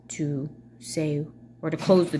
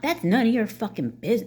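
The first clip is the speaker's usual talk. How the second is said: higher, mock-heated retort; confrontational; 5 semitones higher than usual. Showing how she didn't answer her mother, combative. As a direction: demonstrate the combative way not to say it. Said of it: "it wasn't nothing like that".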